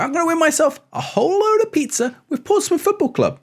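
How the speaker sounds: excited, high-pitched squeal of a sentence; voice going high. Real, not acted. Vlogger thrilled at winning pizza, voice shooting up high. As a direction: let your voice climb very high with excitement. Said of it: "My voice went high then".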